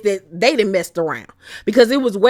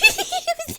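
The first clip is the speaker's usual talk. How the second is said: very high imitating giggle; mock giggle; 5 dB quieter than usual. Imitating Trisha's nervous laugh, very high giggle. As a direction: mimic her silly nervous giggle.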